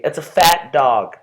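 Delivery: ordinary talk, nothing marked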